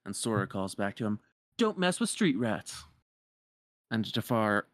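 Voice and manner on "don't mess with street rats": cocky retort. Retold or imitated. Sora retorts to Jafar.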